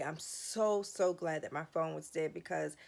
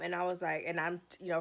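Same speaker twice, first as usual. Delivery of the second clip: ordinary talk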